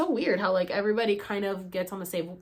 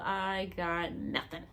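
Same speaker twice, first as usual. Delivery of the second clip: glum mutter; 6 dB quieter, slower than usual. Retold or imitated. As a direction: mutter it, deflated. Disappointed aside.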